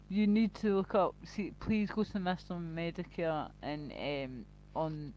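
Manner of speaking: normal talking voice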